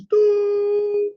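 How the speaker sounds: very high sustained horn-like doo; shofar blast. Retold or imitated. Shlomo blows the shofar for the king's party, long blast. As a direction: imitate a shofar tekiah with the voice. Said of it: "And he goes".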